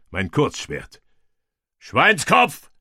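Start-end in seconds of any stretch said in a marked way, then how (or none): 1.8-2.7 rising battle cry command; shouted command. A narrator's reading. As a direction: call the formation out loud. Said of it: "rief ich".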